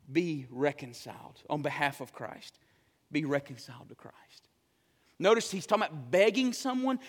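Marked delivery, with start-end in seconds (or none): 0.1-2.6 low, soft pleading; hushed plea. Real, not acted. 3.1-4.5 whispered plea. Real, not acted. Verse repeated in a whisper.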